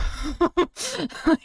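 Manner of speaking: laugh. Real, not acted. Laughing at her own uh-oh.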